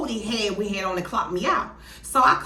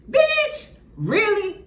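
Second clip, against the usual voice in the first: indignant shock; 13 semitones higher, 3 dB louder, much slower than usual. Real, not acted. Outraged reaction to low pay.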